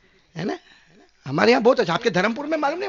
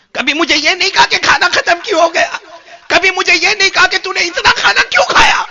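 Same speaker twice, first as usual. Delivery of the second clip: loud, higher, hurt reproach; the fish scolds the prophet in a raised voice; fish's loud complaint; 8 semitones higher, 11 dB louder than usual. A narrator's reading. Fish reproaching Solomon for raising the stick, loud and hurt. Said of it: "ایک جملہ کہا".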